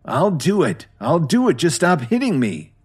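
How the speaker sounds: high, pleading repeated cry; begging. A narrator's reading. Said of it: "Meriwether begged".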